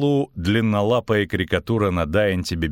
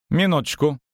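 higher, mildly grumpy bard; slightly grumpy, pitched up; grumbled interjection; 7 semitones higher than usual. A narrator's reading. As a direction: cut in with a grumble. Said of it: "буркнул Лютик".

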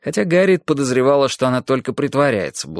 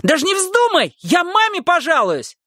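put-on high, shrill boyish threat; shrill yell. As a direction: shriek the threat at the maid. Boy yelling threat. (визгливый крик мальчишки)

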